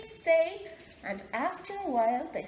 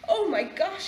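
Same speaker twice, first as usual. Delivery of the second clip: shocked; 6 semitones higher, 7 dB louder than usual. A narrator's reading. Wolf is shocked by the sight.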